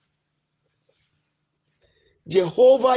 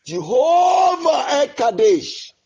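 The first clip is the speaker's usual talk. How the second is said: tearful cry; 5 semitones higher than usual. Real, not acted. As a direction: cry the name out through tears. Crying out God's name.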